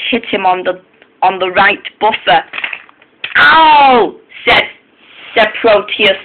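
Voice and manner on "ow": sharp high yelp of pain. Acted. Engine hit by a lorry, high yelp of pain. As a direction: cry out as the lorry hits.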